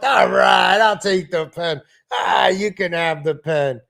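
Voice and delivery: hammed-up sitcom give-in; mock exasperated New Yorker; giving in on the pen. Acting out the pen back-and-forth.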